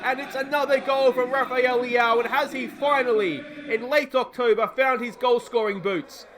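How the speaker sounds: commentator voice. Pretend. Manager narrating his own goal as a TV commentator, grand and drawn out. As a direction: call the goal like a match-day broadcaster.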